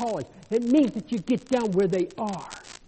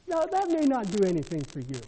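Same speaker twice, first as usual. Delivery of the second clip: normal talking voice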